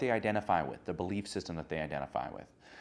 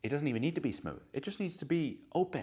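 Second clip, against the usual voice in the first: ordinary delivery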